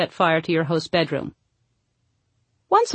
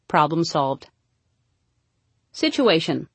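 usual speaking voice

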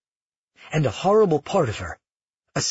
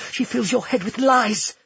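higher-pitched, urgent warning; hissed accusation; 8 semitones higher than usual. Acted. Nerissa warns Amara against her grandmother, hissing accusation. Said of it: "Nerissa hissed".

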